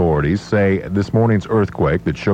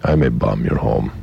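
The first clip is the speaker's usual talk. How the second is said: put-on low, droning German-accented statesman; deadpan accented impression, pitched down in a monotone; Henry Kissinger; 6 semitones lower than usual. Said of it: "let me find Henry Kissinger".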